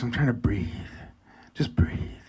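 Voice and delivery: hushed, breathing out the words; softly talking yourself down; self-calming. Retold or imitated. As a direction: drop to a near whisper to calm yourself. Retelling efforts to calm down.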